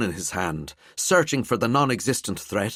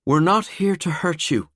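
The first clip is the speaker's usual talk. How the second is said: calmer voice; 10 semitones higher, 4 dB louder than usual. A narrator's reading. Valkyrie calming the frightened pair. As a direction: settle them down gently. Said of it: "in a much calmer voice".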